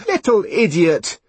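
loud, high, scolding outburst; angry shout. Acted. Mother lashing out at her son, loud angry shout. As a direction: shout the insult in a temper. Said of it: "she shouted".

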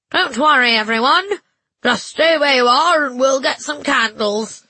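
high, loud nasal churchman; nasal bishop voice. A narrator's reading. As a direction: call out through the nose like a bishop. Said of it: "the nasal voice of the bishop".

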